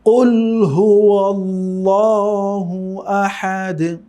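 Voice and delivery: slow melodic tilawat of কুল হুয়াল্লাহু Ahad; Quran recitation. Shown as a demonstration. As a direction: chant it slowly, drawn out like in prayer. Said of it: "তেলাওয়াত করছি".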